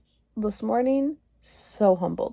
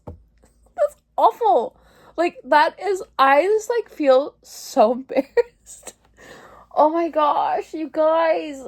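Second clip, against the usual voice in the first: laugh-crying; 7 semitones higher, 3 dB louder, slower than usual. Real, not acted.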